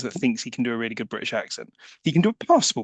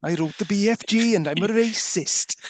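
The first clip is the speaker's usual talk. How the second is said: higher, jokey Welsh lilt; mock Welsh accent; 6 semitones higher than usual. Podcaster joining in the author joke, mock Welsh accent. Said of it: "Roald Dahl Welsh".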